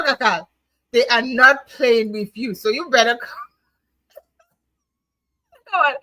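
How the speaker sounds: ordinary talk, nothing marked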